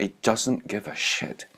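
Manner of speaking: ordinary talk, nothing marked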